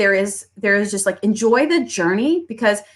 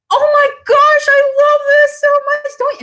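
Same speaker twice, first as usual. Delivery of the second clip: much higher and louder, squealing delight; gushing customer; 6 dB louder than usual. Retold or imitated. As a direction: gush like a thrilled shopper. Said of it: "they go".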